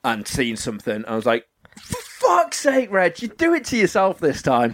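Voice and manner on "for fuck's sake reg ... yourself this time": mock-exasperated self-reproach, pitched up. Retold exasperation at himself.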